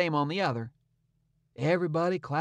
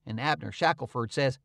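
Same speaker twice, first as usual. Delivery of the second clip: ordinary delivery, nothing marked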